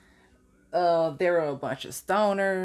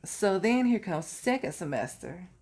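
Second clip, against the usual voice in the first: ordinary talk, nothing marked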